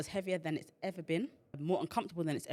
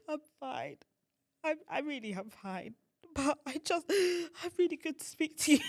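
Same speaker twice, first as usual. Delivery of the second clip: imitated crying; 11 semitones higher than usual. Woman answering how she is, mock-tearful. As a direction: act out choking up through the words. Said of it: "I just found that I was crying".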